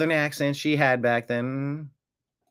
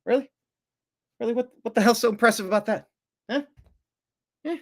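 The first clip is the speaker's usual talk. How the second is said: mocking disbelief; 9 semitones higher, much faster than usual. Mocking the impression again.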